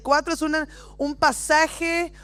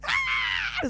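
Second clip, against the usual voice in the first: sudden very high short yelp; mock scream of fright; 6 dB quieter than usual. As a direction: play the terrified disciples screaming. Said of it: "es como una manera de decir".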